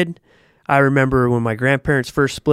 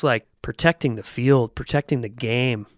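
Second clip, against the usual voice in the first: ordinary talk, nothing marked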